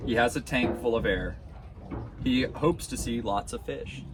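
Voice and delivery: usual speaking voice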